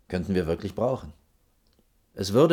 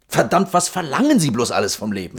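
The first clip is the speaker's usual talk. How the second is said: louder, higher, hitting verlangen; exasperated outburst; 5 semitones higher, 6 dB louder than usual. Acted. Official exasperated by the architect's demands, loud outburst. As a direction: throw up your hands at her.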